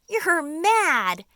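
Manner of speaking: a high, loud shriek of disbelief; cried out. A narrator's reading. Earthworm crying out at James's plan, loud and shrill. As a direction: earthworm yelping in alarm. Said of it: "cried the earthworm".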